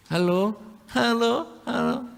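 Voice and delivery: slow, high, drawn-out halo, halo, halo; bored married hello. Retold or imitated. Married spouse answering the phone, slow and weary. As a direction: drag the hellos out like a tired spouse. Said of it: "Tapi kalau sudah suami istri?".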